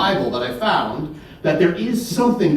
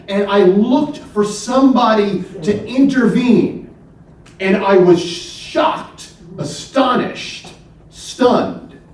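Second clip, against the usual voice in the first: astonished God; 6 semitones higher, slower than usual. Acted. Voicing God's astonishment.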